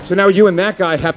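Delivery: ordinary talk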